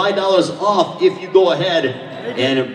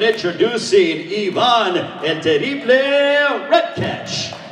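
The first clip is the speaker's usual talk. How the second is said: ring announcer; slower than usual. Real, not acted. Ring announcer calling the fighter.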